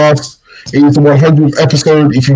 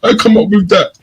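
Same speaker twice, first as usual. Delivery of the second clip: higher, eager bragging schoolkids; mock boastful claim; 8 semitones higher than usual. Imitating friends arguing who said it first, higher. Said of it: "everyone was like".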